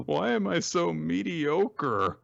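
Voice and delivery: mopey lament. Retold or imitated. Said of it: "so he just says".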